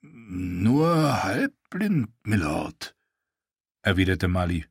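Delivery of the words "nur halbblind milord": drawn-out, gruff guard reply; a lightly voiced guard answering his lord; Molly's voice.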